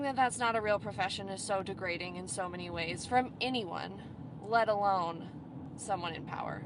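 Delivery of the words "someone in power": whispered aside. Real, not acted. Pointed jab at politicians.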